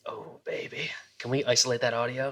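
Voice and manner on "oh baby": put-on breathy whispered oh baby.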